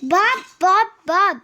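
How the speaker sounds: calling a name loudly. Pretend. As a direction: call Bob from far away. Child calls character in story.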